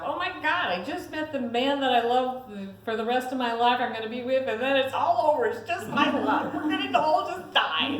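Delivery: high, loud, laughing mock panic. Wife panicking on a landing plane, high mock panic. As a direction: squeal it out half laughing at her own terror. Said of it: "here I'm thinking to myself".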